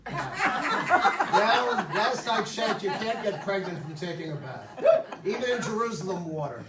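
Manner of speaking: high laughing "Yeah", then laughing on through the joke; laughing through words. Real, not acted. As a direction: laugh while telling the joke. Joking about bath pregnancy.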